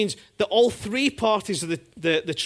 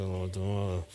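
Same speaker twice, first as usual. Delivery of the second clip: low, quiet mumbled brush-off; husband dodging his wife's question with a teen mumble; sulky teenager mumble; 11 semitones lower, 10 dB quieter than usual. Retold or imitated. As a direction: mumble it as if there is nothing to say. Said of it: "I feel like a teenager".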